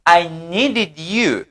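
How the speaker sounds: "I needed you" très lentement, en appuyant sur "needed"; slow model sentence. Shown as a demonstration. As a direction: drag out "I needed you" for learners. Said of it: "You see". ("I needed you" lentement, "needed" accentué)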